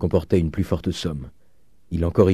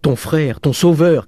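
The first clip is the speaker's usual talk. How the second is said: high, mounting plea to a loud shout; crying out; 8 semitones higher, 5 dB louder, slower than usual. A narrator's reading. Old father pleading with his daughter for Eugène, crying out. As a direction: cry it out, louder with each phrase. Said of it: "cria le père Goriot".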